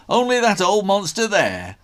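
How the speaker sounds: hearty workman calling up high and loud; a cheery Aussie builder shouting over noise; gruff workman. A narrator's reading. Workman calls to the boy, loud and high.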